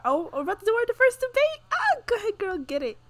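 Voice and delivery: high, loud squeal of excitement; excited cheering. Real, not acted. Player cheering her Sim into a first debate, excited squeal.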